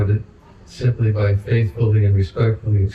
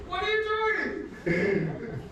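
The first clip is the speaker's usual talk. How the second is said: retold scream; 9 dB quieter, much slower than usual. Devotee objecting to his point being moved, screaming in imitation. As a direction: imitate an outraged screech in a thin falsetto, held back in volume. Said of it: "he started screaming".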